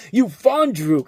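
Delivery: hint of a loud, high SNL sketch insult; lightly voiced mock sketch-comedy insult; Chris Parnell sketch voice. Reviewer joking about a made-up word, in a light SNL sketch voice. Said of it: "I'm like a Chris Parnell on a Saturday Night Live".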